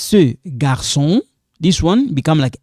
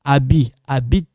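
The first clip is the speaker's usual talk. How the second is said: ordinary delivery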